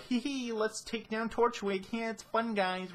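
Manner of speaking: high-pitched mock-gleeful cartoon voice; mock carefree giggly. Mocking how lightly the old arc took it, high and giddy. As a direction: put on a silly, high, giddy voice.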